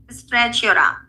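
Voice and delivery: ordinary talk, nothing marked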